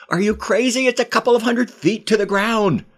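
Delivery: frantic, high-pitched outburst; alarmed shout. Acted. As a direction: shout in alarm at his madness. Friend walking toward cliff edge.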